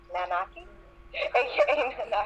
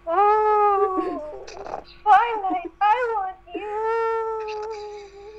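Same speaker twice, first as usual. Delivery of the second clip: much higher, drawn-out crying wail; high falsetto longing; 12 semitones higher, much slower than usual. Acted. Trixie pining for Twilight, a high hammed-up wail.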